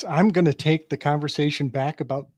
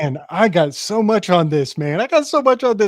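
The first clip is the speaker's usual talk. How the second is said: excited, louder rush of words; geeking out; 3 semitones higher, 4 dB louder, faster than usual. Real, not acted. Friend bursting to share ideas after listening to Silas, geeking out. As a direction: gush like a real fan who can't wait to speak. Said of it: "Dude, you're geeking".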